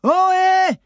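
a long, very high, loud call; shouted hail. A narrator's reading. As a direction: call out across the dark. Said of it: "Maddy hurla". (appel crié, très aigu et fort)

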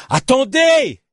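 high, urgent shout; loud cry. Acted. Journalist to despairing companion, urgent shout. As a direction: yell to stop her despairing. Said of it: "cria Lilio presque aussi fort qu'elle".

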